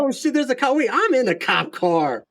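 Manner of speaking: high-pitched joking burst of alarm, then relief; mock panic. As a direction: play at panicking, then reassure yourself. Acting out joke panic in cop car.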